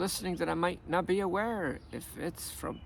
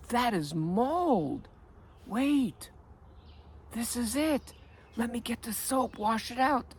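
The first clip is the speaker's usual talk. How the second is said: higher, slow, alarmed at first then hurried; shocked realization; 5 semitones higher, slower than usual. Retold or imitated. Homeowner spotting the mold, in shocked alarm. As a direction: gasp it out like you just found the problem. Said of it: "you should see that shock, that fear".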